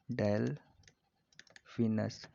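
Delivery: Delphinus broken by a long pause; slow dictation. Shown as a demonstration. Teacher repeats dolphin's name for students, very slowly. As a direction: say Delphinus in slow, separate halves.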